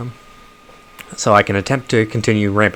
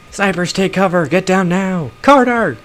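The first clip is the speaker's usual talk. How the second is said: alarmed shout; 10 semitones higher than usual. Acted. Ambush, sniper fire.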